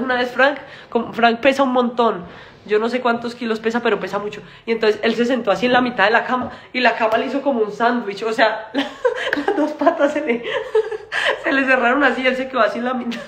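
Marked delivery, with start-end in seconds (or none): 4.6-13.2 can barely finish for laughing. Real, not acted. Telling fans how the bed sandwiched Frank, in fits of laughter.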